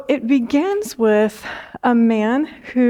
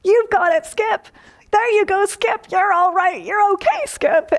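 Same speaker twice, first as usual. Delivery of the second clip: much higher, tender coaxing voice cheering on the kangaroo; loving encouragement; 10 semitones higher than usual. Retold or imitated. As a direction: coax the kangaroo gently in a high voice. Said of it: "her voice and her compassion and her love".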